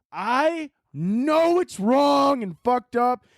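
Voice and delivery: slow, high, drawn-out admission; slow guilty drawl. Acted. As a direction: stretch every word like a guilty confession. Confessing a guilty thought.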